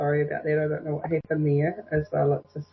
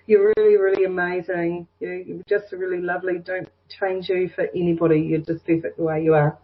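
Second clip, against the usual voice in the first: normal talking voice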